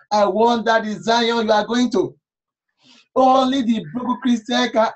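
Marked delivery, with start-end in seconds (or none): none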